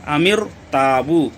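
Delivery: अमिर ताबू slow with a heavy r. Shown as a demonstration. Teacher reciting the example slowly. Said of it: "हम यहाँ पर भी पुर पढ़ेंगे".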